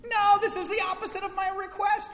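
very high, loud wailing protest; child's tantrum. Retold or imitated. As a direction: throw a little kid's fit.

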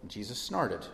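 softly voiced translator at work; low, quiet thinking aloud; translator working through. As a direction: read it under your breath while translating. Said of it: "like, working through".

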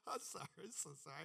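breathless apologetic laugh through words; mock banker laughing. Retelling the banker who laughed at the plan, mocking. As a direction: imitate the banker helpless with laughter.

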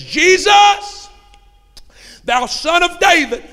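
high, loud cry for help; hollering cry. Retold or imitated. Blind beggar calling to Jesus over the crowd, hollering. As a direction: scream it out to be noticed. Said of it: "holler, scream".

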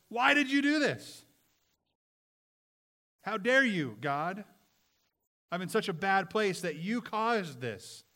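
loud, high outburst with stretched words; angry accusation. Acted. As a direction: hurl the blame up at God. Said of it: "I just get mad".

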